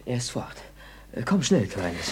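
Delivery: low hushed whisper; whispered urgent call to his hidden girlfriend. Acted. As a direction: hurry her along in a whisper. Hiding girl after father leaves.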